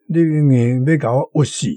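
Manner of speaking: usual speaking voice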